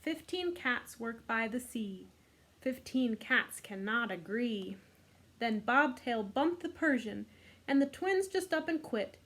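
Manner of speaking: usual speaking voice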